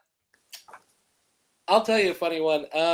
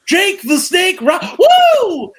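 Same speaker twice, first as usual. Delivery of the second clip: much higher, much louder wrestler woo; Ric Flair shout; 12 semitones higher, 11 dB louder, slower than usual. Retold or imitated. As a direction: explode into a Ric Flair promo. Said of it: "He turned full Ric Flair on me".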